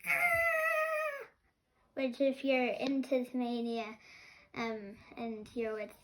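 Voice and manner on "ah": high, sustained screech imitating an animal; imitated animal scream. Host showing how Tasmanian devils scream, imitated screech. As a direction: screech like a Tasmanian devil in one held note. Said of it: "They can scream. They can be like".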